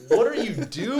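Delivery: high incredulous question; mock disbelief. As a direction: stare him down in disbelief. Disbelief at kid.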